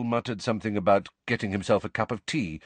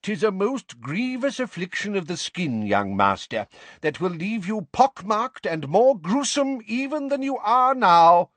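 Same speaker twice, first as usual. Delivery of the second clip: put-on higher, pompous old-fashioned declaiming; medieval healer; 11 semitones higher, 4 dB louder than usual. A narrator's reading. Portrait healer pronouncing doom to a boy, pompous. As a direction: proclaim the diagnosis grandly.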